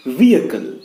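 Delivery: "vehicle" as VEE-uh-kul, falling; silent H. Shown as a demonstration. Teacher giving the correct form, silent H.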